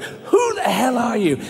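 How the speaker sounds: high, drawn-out indignant challenge; righteous anger. Retold or imitated. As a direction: demand it in mock outrage. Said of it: "And I got righteous anger".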